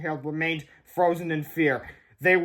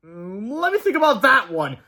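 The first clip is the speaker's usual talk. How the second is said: long swooping mm, higher and louder; sarcastic pondering; 5 semitones higher, 5 dB louder than usual. A narrator's reading. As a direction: pretend to weigh it up. Harold mock-ponders as he flees.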